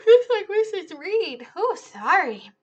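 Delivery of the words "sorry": usual speaking voice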